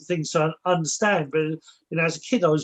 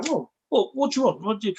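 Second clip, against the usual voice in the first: casual unafraid reply; 5 semitones higher, faster than usual. Retold or imitated. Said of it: "you suddenly go".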